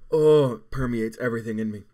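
loud pained groan of words; pained cry. Real, not acted. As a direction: push it out through the burn. Burning, crying from the heat.

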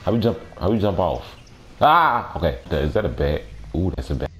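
normal talking voice

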